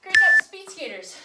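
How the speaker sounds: loud encouraging call over music; cheering shout. Real, not acted. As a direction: shout praise to the class. Coach cheering on class.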